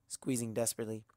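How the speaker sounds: ordinary delivery, nothing marked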